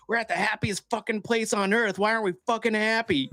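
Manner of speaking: half-mock outburst at the family; exasperated parent. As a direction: vent it like a parent losing it. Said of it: "turn around and be like".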